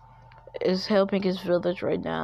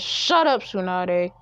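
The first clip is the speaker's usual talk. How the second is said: angry snap. Acted. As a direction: cut her off in anger. Naruto snaps at Tsunade.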